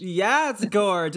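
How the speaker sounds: playful diva imitation; drag queen gush. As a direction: say it like a beauty influencer. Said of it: "a drag queen or, like, a beauty influencer".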